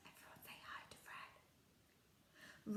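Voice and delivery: hushed whisper to the class; secretive classroom whisper. Real, not acted.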